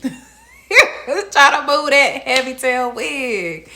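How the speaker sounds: slow and loud through laughter; laughing. Real, not acted. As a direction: laugh out each word of it. Laughing at the wig.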